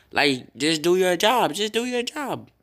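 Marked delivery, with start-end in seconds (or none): 1.5-2.5 much higher, pleading repeat; exasperated. Real, not acted. Streamer scolding the glitchy recording app, high exasperated repeat.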